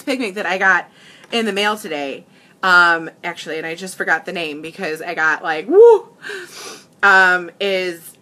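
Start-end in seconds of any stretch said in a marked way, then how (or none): 5.7-6.1 short, loud, high "woo"; high whoop. Real, not acted. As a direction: let out a sudden rising whoop. Imitates being overcome by emotion.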